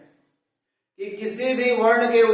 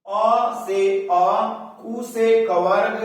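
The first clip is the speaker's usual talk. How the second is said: each part drawn out for students; slow, deliberate; much slower than usual. Shown as a demonstration. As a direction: spell it out slowly, piece by piece. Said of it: "ध्यान दीजिए". (धीरे-धीरे समझाते हुए)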